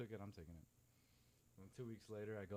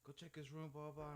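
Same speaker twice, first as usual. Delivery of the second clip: mocking stepdad; 7 semitones higher than usual. Mocking stepdad yelling accusations.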